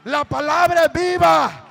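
preaching at full force. Acted. Preacher proclaims the power of the word to the congregation, shouting. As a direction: hammer each line on la palabra.

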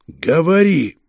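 firm, insistent command; pressing. A narrator's reading. Detective pressing the suspect alone, insistent demand. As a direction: lean on the word to force an answer. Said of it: "с нажимом произнёс".

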